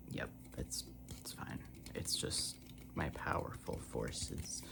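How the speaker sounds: hushed, soft self-reassurance; soft self-soothing. Real, not acted. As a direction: talk yourself down quietly, low and soft. Soothing herself over the light.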